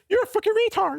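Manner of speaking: put-on very high squeaky drunk insult. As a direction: shriek it like the drunk patron. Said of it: "his voice gets all high".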